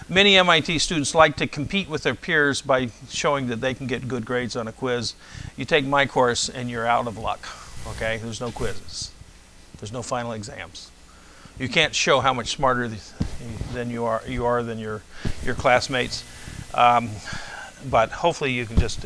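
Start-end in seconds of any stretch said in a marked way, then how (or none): none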